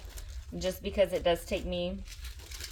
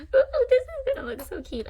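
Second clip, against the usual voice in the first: very high-pitched squeal of delight; giddy, sky-high cooing; excited; 4 dB louder than usual. Real, not acted. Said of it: "I get so excited".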